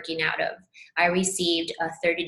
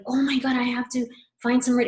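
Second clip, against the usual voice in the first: higher, fast, retold frustration; mock frustrated panic; 5 semitones higher, 4 dB louder, faster than usual. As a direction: rush it like a flustered retold panic. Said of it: "I was so frustrated".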